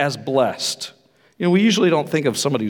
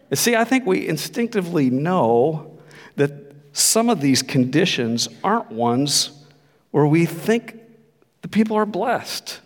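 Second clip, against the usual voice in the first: ordinary talk, nothing marked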